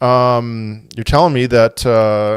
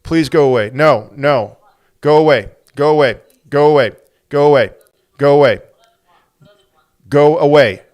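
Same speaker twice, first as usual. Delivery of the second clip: louder, repeated firm go away; insistent go away; 4 semitones higher, 6 dB louder than usual. Real, not acted. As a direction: keep pushing the challenger off. Repeatedly tells challenger to go away.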